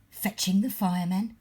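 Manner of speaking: ordinary delivery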